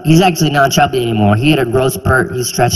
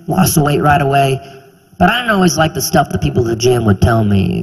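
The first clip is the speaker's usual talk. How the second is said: normal talking voice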